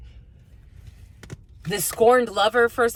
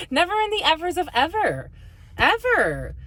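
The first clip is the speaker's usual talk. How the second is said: laughing through a higher playful line; 8 semitones higher than usual. Real, not acted. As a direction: giggle it out, amused at the wordplay. Laughing at her own phrase.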